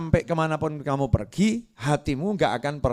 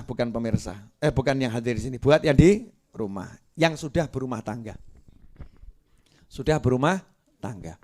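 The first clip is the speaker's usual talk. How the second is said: usual speaking voice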